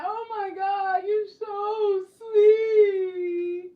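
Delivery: high, drawn-out gushing voice; gushing admirer. Retold or imitated. Fans gushing over her, high and slow. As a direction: coo it like an adoring fan. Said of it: "People be like".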